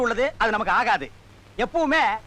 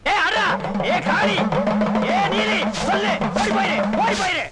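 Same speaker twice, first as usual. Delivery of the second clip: exorcist incantation; 3 semitones higher than usual. Acted. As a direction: order the spirit out like a village priest. Priest performs exorcism chant.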